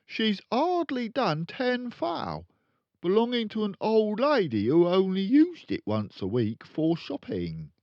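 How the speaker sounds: loud, high, brash dealer father; boastful crooked dad. Acted. Father bragging to his kids, loud and brash. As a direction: brag like a swaggering car salesman.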